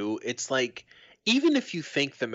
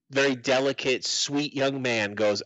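normal talking voice

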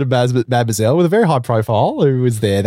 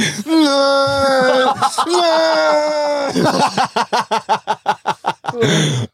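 howling laugh; 12 semitones higher than usual. Real, not acted. Long loud laugh.

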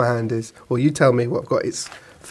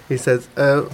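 normal talking voice

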